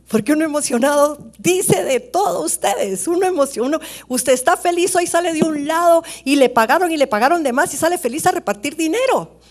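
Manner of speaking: loud, high, fervent sermon voice; rising to a shout to stir the congregation; full-force preaching. Acted. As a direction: preach it out at the top of your voice.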